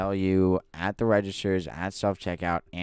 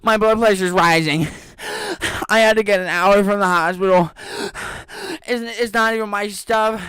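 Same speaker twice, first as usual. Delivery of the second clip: very high, loud mock hysteria; melodramatic customer; 14 semitones higher, 9 dB louder than usual. Imitating the customer demanding water, hammed-up high panic. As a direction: play her breathless meltdown, a pitch higher and louder. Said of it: "she's like".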